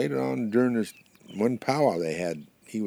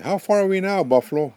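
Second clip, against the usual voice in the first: high, loud storybook call; 12 semitones higher, 11 dB louder than usual. Acted. Swallowed porcupine asks buffalo how far, calling out. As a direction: call up a question from inside.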